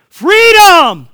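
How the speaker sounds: high, very loud, drawn-out triumphant shout; full-voiced scream, falling at the end; screamed cry of freedom. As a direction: belt it out like a battle cry. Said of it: "I just started screaming".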